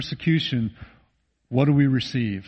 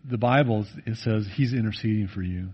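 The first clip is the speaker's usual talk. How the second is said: ordinary talk, nothing marked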